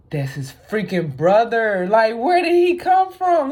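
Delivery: high, loud disbelieving outburst; shocked. Retold or imitated. Vlogger reliving the shock of seeing Itachi, stunned. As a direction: blurt it out in total shock at the reveal. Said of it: "I'm like".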